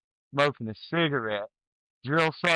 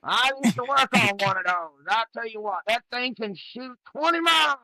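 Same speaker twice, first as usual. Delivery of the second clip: a hint of the proud old soldier, higher; bragging old vet; 9 semitones higher than usual. Old artillery vet bragging to bored kids, higher and proud. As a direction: boast to the kids like a nostalgic veteran. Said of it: "I wanna be that guy".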